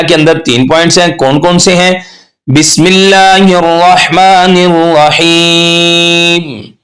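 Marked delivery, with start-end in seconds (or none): 2.5-6.7 slow, melodic recitation. Shown as a demonstration. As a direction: recite slowly and chant it. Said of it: "अब यहां पर बिस्मिल्लाह". (धीमी तिलावत)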